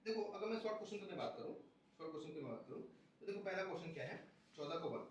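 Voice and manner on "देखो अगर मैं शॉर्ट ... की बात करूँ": usual speaking voice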